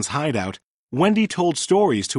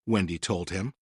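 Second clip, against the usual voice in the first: ordinary delivery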